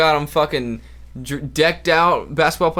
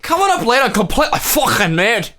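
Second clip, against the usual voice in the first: higher-pitched mock Scottish announcer; 6 semitones higher, 4 dB louder than usual. Scottish Playboy Channel promo, hammed-up accent. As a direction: sell the show in a broad joke Scottish accent.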